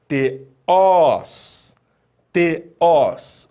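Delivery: Teós split as te, then a loud long ós; final-syllable stress. Shown as a demonstration. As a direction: model Teós for repeating, leaning on the final ós. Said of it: "Fala comigo aí".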